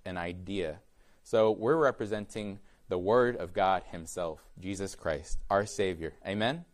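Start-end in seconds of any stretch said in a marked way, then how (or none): none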